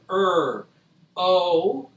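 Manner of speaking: "er, O" as separate drawn-out sounds; sounded out. Shown as a demonstration. Teacher models throne for pupils, sounded out. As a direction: hold "er", pause, then "O".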